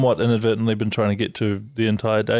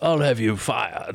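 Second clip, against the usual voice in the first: put-on drunken posh sneer; slower than usual. Mock entitled posh threat.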